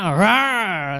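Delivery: loud playful growl; imitating the other person's furious outburst; mock roar. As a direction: roar it like an angry beast. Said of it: "Sabi niya".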